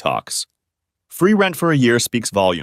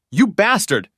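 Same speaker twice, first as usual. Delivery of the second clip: higher, hammed-up furious cry; a higher mock angry shout; 10 semitones higher, slower than usual. A narrator's reading. Wife shouting at her jailed husband, higher mock fury. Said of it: "she shouted".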